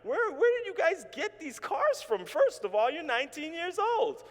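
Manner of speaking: high, loud incredulous questioning; flabbergasted, pitch leaping high; acted disbelief. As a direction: demand answers in mock shock, voice shooting up. Acts shock at friends' expensive cars.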